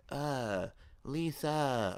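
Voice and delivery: lightly mocking movie-actor imitation; Tommy Wiseau impression. Viewer quoting the movie's lead, mock imitation. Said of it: "or whatever the fuck Tommy says".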